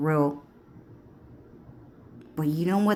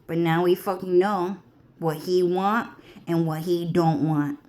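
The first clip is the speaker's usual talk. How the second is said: ordinary talk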